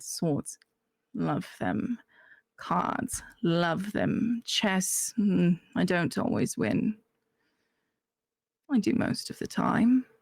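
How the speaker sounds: put-on accented character voice, measured; put-on accent with long pauses; [name]. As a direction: weigh each choice in the character's accent. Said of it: "I didn't do the accent".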